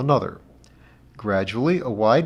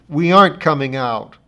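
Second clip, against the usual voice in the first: defiant; 4 semitones higher, 8 dB louder than usual. A narrator's reading. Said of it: "word defiance".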